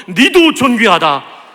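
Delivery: slow, loud blessing to a neighbour; drawn-out and emphatic, like a high five; slow loud encouragement. Shown as a demonstration. Pastor urging neighbours to bless each other, slow and loud. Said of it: "옆에 있는 사람 격려합시다".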